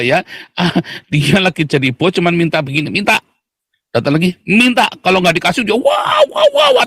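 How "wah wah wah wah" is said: imitated tantrum wail, much higher; mock a toddler's tantrum, shrill-high wah wah. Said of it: "tantrum dia".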